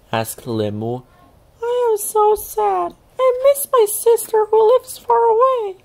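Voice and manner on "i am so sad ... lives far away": very high, tiny sad voice; a small creature weeping for her sister; high squeaky sad little voice; crying little old Pai Hai. A narrator's reading. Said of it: "cried the little old Pai Hai".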